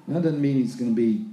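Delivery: ordinary talk, nothing marked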